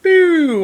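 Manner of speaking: high, loud falling arrow whistle; arrow shot noise. Pretend. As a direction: shoot an imaginary arrow with your voice. Imitating another arrow shot.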